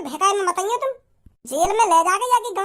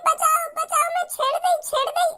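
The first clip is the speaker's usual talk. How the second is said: a higher, mock girl's cry for help; boy imitates the girl's fake screams, raised higher; 5 semitones higher than usual. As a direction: ham up her fake shouting. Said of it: "हल्ला मचाने लगी".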